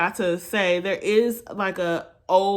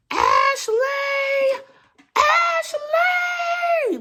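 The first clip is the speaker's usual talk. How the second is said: retold scream; 3 dB louder, much slower than usual. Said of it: "my cousin screaming at the top of her lungs".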